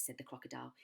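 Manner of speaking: plain reading voice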